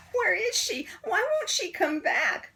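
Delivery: high, whining questions; pleading kids. A narrator's reading. Kids asking about Miss Nelson, high and pleading. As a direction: plead like missing children.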